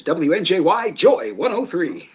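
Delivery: slow, drawn-out jingle-style DJ announcer; radio station ID. Shown as a demonstration. Former DJ showing friends his old station ID, radio DJ voice. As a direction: sell the call letters slowly like a radio jock.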